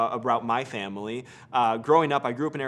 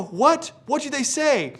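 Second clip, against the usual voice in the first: high loud what; excited disbelief; 13 semitones higher, 7 dB louder, slower than usual. Retold or imitated. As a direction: shout back in astonishment. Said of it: "And I go".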